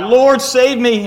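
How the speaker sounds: high, loud, drawn-out plea; desperate cry to the Lord, stretched long. A narrator's reading. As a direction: cry out for rescue while reading aloud. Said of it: "He cried out".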